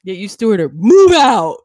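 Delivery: shouted command, laughing; loud declaration. Acted. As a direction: belt it out as a joke. Joking bossy declaration.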